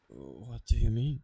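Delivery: normal talking voice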